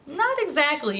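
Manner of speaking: a touch of embarrassed hedging; lightly sheepish dodge. Acted. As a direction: admit it a little sheepishly. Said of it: "you tell them sheepishly".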